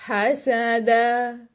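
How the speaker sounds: "हसद" very slowly, each vowel dragged; overlong fatha. Shown as a demonstration. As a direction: stretch every vowel far too long. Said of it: "no, this is not acceptable". (गलत, बहुत लंबा खींचा हुआ "हसद")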